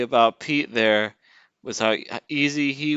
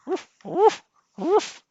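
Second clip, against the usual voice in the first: high yapping woof woof woof, each rising; mocking the dog with high rising barks; mock barking; 5 dB louder, much slower than usual. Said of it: "That's what you sound like".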